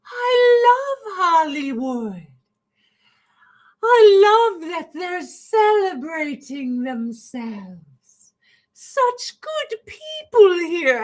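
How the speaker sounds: high, slow, loud, tearful gushing; wailing, over-the-top gushing actor at an awards show; Jim Carrey impression. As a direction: overcome with emotion, nearly crying about how great Hollywood is. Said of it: "And then he's like".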